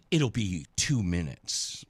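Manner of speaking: low, slow put-on man's voice; dragged-out low reply; imitated official. Acting the man who walks up.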